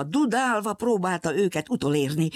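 usual speaking voice

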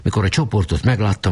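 plain reading voice

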